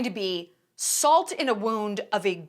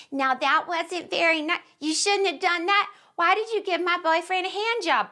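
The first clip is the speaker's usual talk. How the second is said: mock whiny accuser; 6 semitones higher than usual. Mock offended person quoted.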